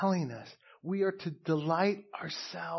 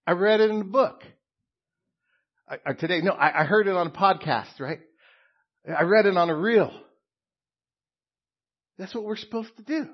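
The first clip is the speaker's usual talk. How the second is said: mocking husband; 5 semitones higher, 8 dB louder, faster than usual.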